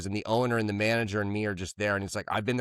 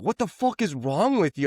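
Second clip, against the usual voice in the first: annoyed boss; 6 semitones higher than usual. Retold or imitated. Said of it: "And he's just like".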